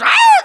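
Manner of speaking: sudden high mock yelp; hammed-up pained yelp; dog yelp. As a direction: squeal like a stepped-on dog. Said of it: "And she goes".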